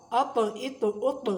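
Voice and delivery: اط with the ط bouncing back; qalqala bounce. Shown as a demonstration. Teacher demonstrating qalqala on طا. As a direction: rattle the طا at the end. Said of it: "بڑے مزے کی آواز نکلتی ہے ایسے".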